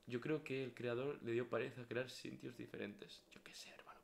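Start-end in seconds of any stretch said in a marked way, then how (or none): 3.3-4.0 soft voiceless whisper; nervous whisper. Real, not acted. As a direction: whisper it, spooked. Scared while exploring. (susurro nervioso)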